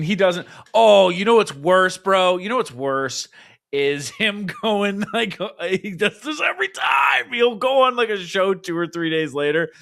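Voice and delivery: ordinary delivery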